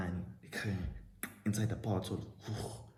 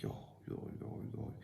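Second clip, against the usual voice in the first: whispered stunned "yo"; shocked whisper; 14 dB quieter than usual. Retold or imitated. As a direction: breathe it out in shock. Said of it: "I keep quiet and I'm shocked".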